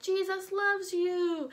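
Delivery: slow, high cheerful chant; drawn-out friendly preaching; sing-song preachers. Acted. Peter and John greet the beggar, slow cheerful chant. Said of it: "Peter and John come walking".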